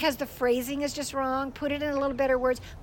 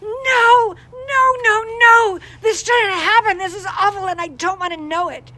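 retold crying; 9 semitones higher, 13 dB louder than usual. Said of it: "she goes".

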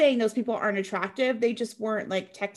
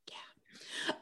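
ordinary talk

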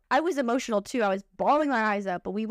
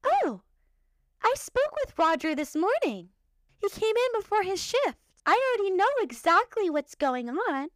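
higher prim mock voice; a higher, lightly mocking HR voice; mocking HR lady; 8 semitones higher than usual. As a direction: imitate the smug HR lady. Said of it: "She looks at me and she goes".

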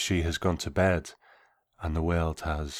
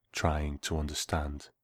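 plain reading voice

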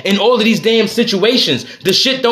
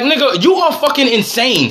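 normal talking voice

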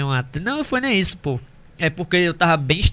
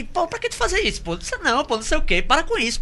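higher whiny plea; mock hurt complaint; 10 semitones higher, 4 dB quieter than usual. Imitating himself complaining to girlfriend at the table. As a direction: plead like a wounded boyfriend.

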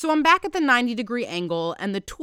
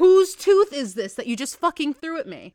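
higher and louder, real shock; shocked outburst; 7 semitones higher, 8 dB louder than usual. Real, not acted. To her boyfriend who just threw a tooth, real shocked outburst. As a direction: caught off guard, demanding an answer.

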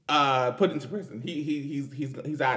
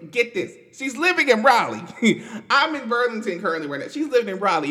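worked-up disbelief; 8 semitones higher, 7 dB louder, faster than usual. Real, not acted. Outraged news she lives nearby.

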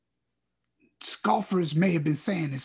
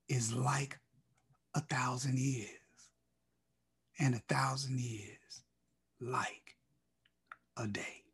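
softer, breathed out in a whisper; hushed whisper; 8 semitones lower, 11 dB quieter than usual. Acted. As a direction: let the verse fall to a whisper in awe. Scripture verse dropped to whisper.

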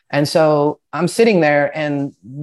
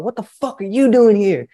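raised-pitch mock yell; imitated yelling; 6 semitones higher, 4 dB louder than usual. Monster guy confronting the newcomer, imitated yelling. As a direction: challenge like a huge angry inmate, retold. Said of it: "he's yelling at these guys".

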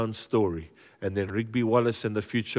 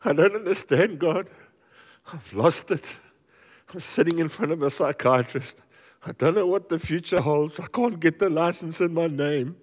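higher, strained cry to God; pleading prayer; 7 semitones higher than usual. Acted. Troubled man praying to God, high pleading voice. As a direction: beg God as if at the end of your rope.

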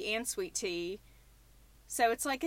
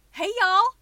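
big, high, loud country twang; hammed-up Southern country holler; exaggerated country greeting; 9 semitones higher, 10 dB louder than usual. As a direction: lay the country accent on thick. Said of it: "making fun of sounding country".

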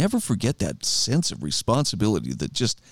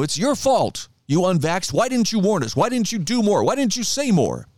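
mimicking the vaccinated blaming the unvaxxed; mocking whiny complaint; 6 semitones higher than usual. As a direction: whine the blame back like a sulking accuser. Mocking the headline's accusations again.

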